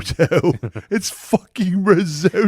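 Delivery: hammed-up angry chef shout; Gordon Ramsay. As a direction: rant it like a disgusted TV chef. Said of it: "Gordon Ramsay would just be like".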